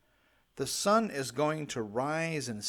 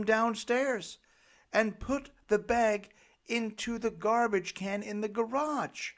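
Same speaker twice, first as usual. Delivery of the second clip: higher pleading parent voice; begging; 7 semitones higher than usual. Retold or imitated. Parent begging child to carry trash upstairs. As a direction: plead with them, a little slowed.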